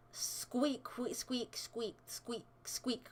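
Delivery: fading squeaks. Pretend.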